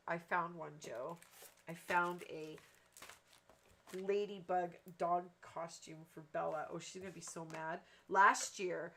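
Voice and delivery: usual speaking voice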